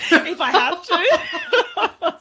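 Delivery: high, loud, dragged out into laughter; laughing. Retold or imitated. Mum joking about the teacher's call, laughing loudly. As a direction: crack up while saying it.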